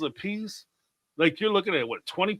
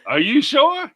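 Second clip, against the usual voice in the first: mock disbelief; 9 semitones higher, 8 dB louder, slower than usual. Joking along with retold encounter.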